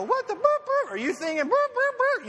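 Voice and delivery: squeaky high burp sounds bleeping out the swearing; hammed-up angry driver, curses replaced by high chirps; mock censored rant. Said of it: "they had every right to get out of that car and go".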